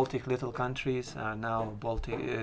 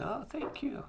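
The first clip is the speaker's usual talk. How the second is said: much higher, a little softer thank-you; 14 semitones higher, 4 dB quieter than usual. Retold or imitated. Retold reply to a stranger's compliment, much higher and softer. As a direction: pitch the thanks way up.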